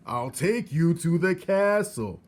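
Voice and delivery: high, loud, slow shout. Acted. Soldier shouting to hard-of-hearing Mig. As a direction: spell it out loudly for the deaf girl. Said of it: "shouted the soldier".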